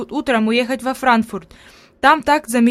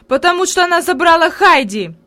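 blurting it out louder and higher; blurted; 6 semitones higher, 5 dB louder than usual. A narrator's reading. Boy blurts to his grandmother, upset and loud. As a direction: burst out with it. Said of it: "выпалил Питер".

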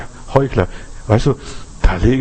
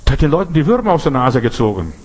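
usual speaking voice

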